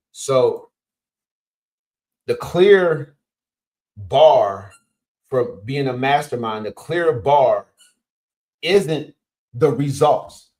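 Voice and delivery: slow, loud, word-by-word delivery; slow and emphatic lecture line; slowed for note-takers. Real, not acted. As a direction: spell it out slowly so they can write it down. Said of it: "so I gotta slow down".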